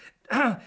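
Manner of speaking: loud high little throat clear. Acted. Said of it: "He cleared his voice".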